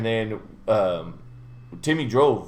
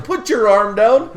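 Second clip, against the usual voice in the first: raised, high, slow mock yell; retold alarmed order, loud and high; urgent warning; 10 semitones higher, 8 dB louder, slower than usual. Passenger stopping his friend photographing the cop, urgent mock yell.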